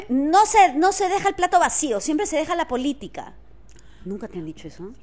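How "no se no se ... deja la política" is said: higher and louder, mom laying down the rule; recalling her mother's dinner-table rule, higher and louder; quoting mom. Retold or imitated. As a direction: imitate mother lecturing at the table. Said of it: "como decía mi mamá".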